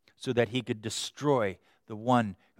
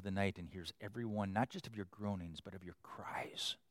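hushed tender preaching; 7 semitones lower, 13 dB quieter than usual. Acted. Preacher softly tells listeners God draws close, hushed. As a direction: draw them in with a hush. Sermon softens to near whisper.

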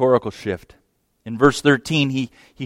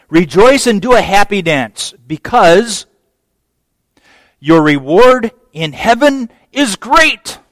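"Rejoice and do a happy dance" higher and louder, bursting with joy; joyful exclaiming read, louder and higher; jumping for joy; 6 semitones higher, 7 dB louder than usual. Shown as a demonstration. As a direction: spill over with joy as you read it. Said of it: "This is a jumping up and down".

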